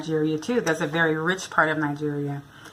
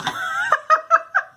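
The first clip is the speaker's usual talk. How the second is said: burst of laughter. Real, not acted. Guard's gesture makes her laugh.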